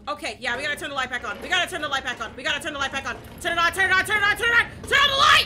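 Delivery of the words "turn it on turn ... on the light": building to a high, loud yell; panicked shout. Real, not acted. Player begging the game for light, panicked yelling. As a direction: scream at the game to turn the light on.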